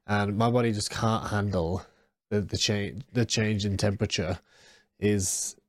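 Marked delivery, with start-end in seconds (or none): none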